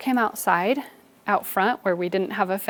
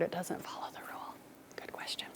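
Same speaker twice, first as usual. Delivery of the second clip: whisper; 3 semitones lower, 19 dB quieter, faster than usual. Real, not acted. Teacher's aside to one student.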